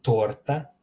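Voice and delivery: "torta" with a trilled R, lifting at the end; rolled R. Shown as a demonstration. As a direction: roll the R clearly in "torta". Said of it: "roll the R".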